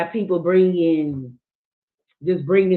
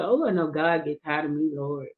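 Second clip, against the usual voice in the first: softer, murmured plea to the Lord; quiet prayer; 4 semitones lower, 7 dB quieter than usual. Retold or imitated. Talking to God in traffic, murmured softly. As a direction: mutter it as a tired little prayer. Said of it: "I say".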